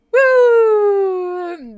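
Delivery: loud high woo; cheer. Real, not acted. To the chat, cheering the best moment of the season, loud woo. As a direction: whoop with joy.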